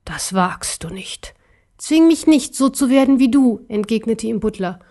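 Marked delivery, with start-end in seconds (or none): 0.0-1.4 slow, menacing whisper; whispered warning. Acted. Emperor frozen in shock. 1.8-3.6 high, urgent threat; defiant little bear. Acted.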